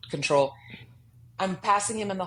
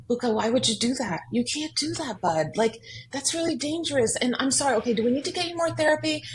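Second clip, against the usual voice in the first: higher, rushed worried-parent scolding; panicked lecturing parent; 6 semitones higher than usual. Retold or imitated. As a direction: fire off the questions like a frightened mom. The old panicked, controlling reaction.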